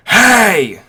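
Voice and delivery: loud, high shout to a crowd; big, sudden attention-getting holler; yelled call. Acted. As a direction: yell out to grab everyone's attention. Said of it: "to yell out to the whole crowd".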